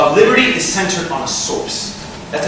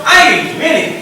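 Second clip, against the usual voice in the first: raised, higher and louder refusal; mock brother's refusal; 10 semitones higher, 4 dB louder, much faster than usual. The cook brother refusing Esau, higher and laughing. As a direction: tease him with a laugh.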